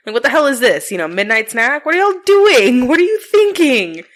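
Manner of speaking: put-on high, loud exasperated yell at the kids; exasperated scolding. Mom yelling at the kids about dough on the wall, exasperated. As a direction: demand an answer, half shouting.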